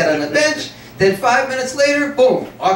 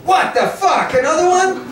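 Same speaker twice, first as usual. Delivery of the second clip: imitating startled people; 9 semitones higher than usual. People reacting to a second blast.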